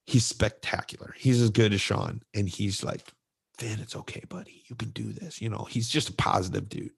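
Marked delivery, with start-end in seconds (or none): 3.6-5.4 soft, hushed encouragement; a gentle pep talk to a scared kid; encouraging coach. Retold or imitated. Coach quietly encourages the crying boy, soft and kind.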